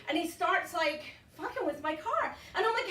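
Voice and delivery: ordinary talk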